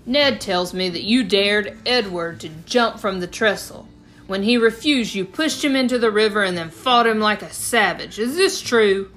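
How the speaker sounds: cross-examine him like a stern judge; stern father. A narrator's reading. Father questioning his son before a whipping, stern and loud. Papa voiced confronting the boy.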